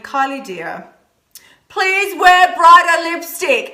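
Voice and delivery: lauter, höher gezogenes "please wear brighter lipstick"; imitating fans pleading. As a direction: langsam und bittend, wie ein drängender Fan. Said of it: "Sending me messages and saying".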